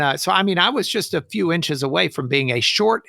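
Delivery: ordinary talk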